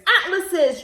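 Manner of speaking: ordinary delivery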